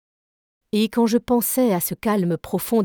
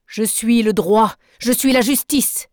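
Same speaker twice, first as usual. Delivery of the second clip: solemn proclamation; 3 semitones higher, 4 dB louder than usual. Acted. Nemo answering the narrator, a loud solemn proclamation. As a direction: proclaim it like a verdict.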